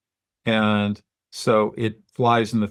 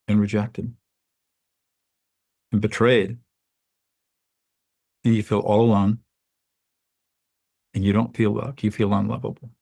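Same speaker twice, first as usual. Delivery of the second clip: mediator therapist delivery. Shown as a demonstration. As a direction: lean in like a mediator naming his pain. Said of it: "immediately transitioned into that mediator slash therapist delivery".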